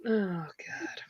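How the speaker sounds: ordinary talk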